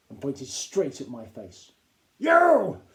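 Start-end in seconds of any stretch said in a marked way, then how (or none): 2.2-2.8 high, loud barked shout; captain yelling at a pupil; furious shout. A narrator's reading. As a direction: blast it across the classroom. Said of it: "he shouted".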